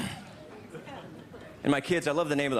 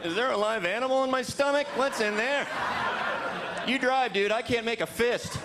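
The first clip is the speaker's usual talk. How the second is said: mock queasy eater; 9 semitones higher than usual. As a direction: whine like someone sick from sliders.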